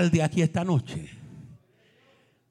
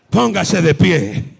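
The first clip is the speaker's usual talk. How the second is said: much louder, slowed command to stand; 2 semitones higher, 10 dB louder, slower than usual. Real, not acted. The preacher commanding the congregation to stand, louder. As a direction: call them to their feet.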